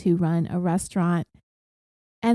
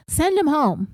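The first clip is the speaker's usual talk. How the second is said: mild mock shout, pitched up; mock yell; 6 semitones higher than usual. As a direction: imitate a yell without full volume. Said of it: "And then Amy yells".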